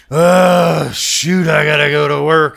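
loud grumbling at waking up; mock groan of dread. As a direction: play the weary worker dreading work. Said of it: "But he would go".

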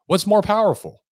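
emphatic question. Shown as a demonstration.

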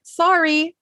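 high, chirped sorry; quick sorry. Retold or imitated. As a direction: squeak a reflexive sorry. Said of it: "and went".

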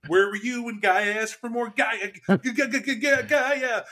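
high-pitched frantic nag; mock nagging orders. Mimicking nagging werewolf marching orders.

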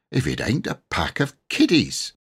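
usual speaking voice

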